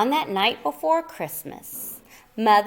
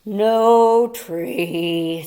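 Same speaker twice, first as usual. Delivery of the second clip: louder, slow, sorry "no tree"; sad Moose sigh; 3 semitones lower, 4 dB louder than usual. Acted. Moose sighs, coming home empty-handed, slow and defeated. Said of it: "sighed sad Moose".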